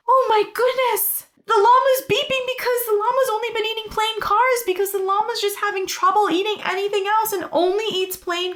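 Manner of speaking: high, fast squeaky llama voice. Acted. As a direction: rattle it off in a tiny llama squeak. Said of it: "That's my llama voice".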